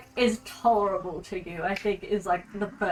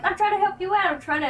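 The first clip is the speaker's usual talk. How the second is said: pleading student; 10 semitones higher, faster than usual. Pretend.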